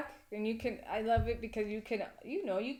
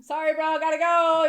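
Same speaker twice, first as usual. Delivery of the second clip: higher, much louder rushed brush-off; mock hurried excuse; 8 semitones higher, 12 dB louder than usual. Fleeing a doorbell visitor, loud and high. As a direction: blurt it out running for the car.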